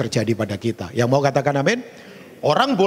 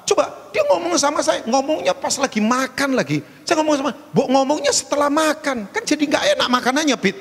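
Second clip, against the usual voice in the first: lively retelling; 11 semitones higher than usual. Retold or imitated. Retold complaint while eating.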